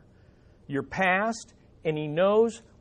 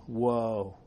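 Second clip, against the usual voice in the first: awed hush; 8 semitones lower, 11 dB quieter than usual. Shown as a demonstration. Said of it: "We ought to be saying".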